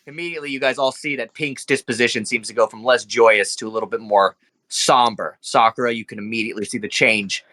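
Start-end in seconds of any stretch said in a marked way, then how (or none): none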